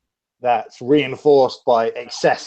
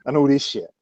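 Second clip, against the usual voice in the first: usual speaking voice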